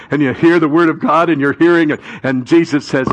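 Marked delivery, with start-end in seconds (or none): none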